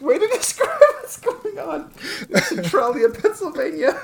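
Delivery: high-pitched, laughing through the words. Real, not acted. Co-host reacting to the town's hubris, laughing hard. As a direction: crack up while talking. Cracking up at the story.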